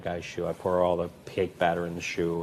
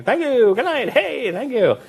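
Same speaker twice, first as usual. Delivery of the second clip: much higher, louder stage farewell; beaming onstage sign-off to the crowd; showbiz thank-you; 9 dB louder than usual. Retold or imitated. Performer thanking the crowd, high and loud. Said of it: "finishing and saying".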